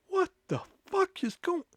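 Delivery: hushed, high, bewildered whisper; stunned reaction. Retold or imitated. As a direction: re-enact speechless disbelief under your breath. Stunned reaction to being yelled at.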